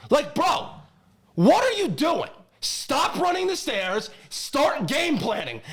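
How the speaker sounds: loud, high exasperated rant; worked up. Real, not acted. Fan fed up with his team's coach, worked up and high. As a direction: punch it out in pleading exasperation. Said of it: "getting worked up about the Jets".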